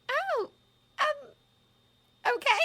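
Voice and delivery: put-on high, hesitant young girl; flustered girl. A narrator's reading. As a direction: caught off guard, unsure. Hermione caught off guard.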